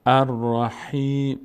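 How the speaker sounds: "الرحیم" slowly, with a drawn-out "hee"; long ee. Shown as a demonstration. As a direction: stretch the "حی" in "الرحیم". («الرحیم» شمرده با ای کشیده)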